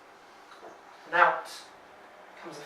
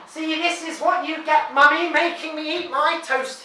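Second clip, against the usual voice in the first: raised, higher call up the stairs; a boy calling up to Mummy, taunting; taunting call, louder and higher; 7 semitones higher, 6 dB louder than usual. A narrator's reading. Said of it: "he calls".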